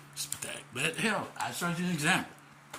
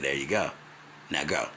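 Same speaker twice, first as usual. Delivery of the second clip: lower mentor voice; 13 semitones lower than usual. Retold or imitated. Quoting mentor again.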